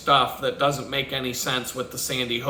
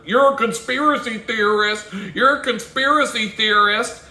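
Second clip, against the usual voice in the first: mocking critics; 7 semitones higher, 4 dB louder than usual. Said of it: "whenever I talk about how".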